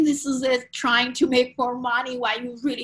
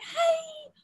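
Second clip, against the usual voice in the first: calm, positive doctor; 9 semitones higher, slower than usual. Retold or imitated. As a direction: break bad news as kindly as possible. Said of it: "super calm and positive about it".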